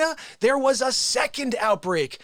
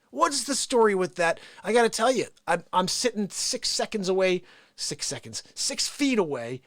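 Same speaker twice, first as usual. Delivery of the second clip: ordinary talk, nothing marked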